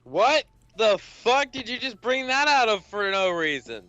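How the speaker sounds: ordinary talk, nothing marked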